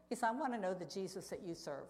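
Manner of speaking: usual speaking voice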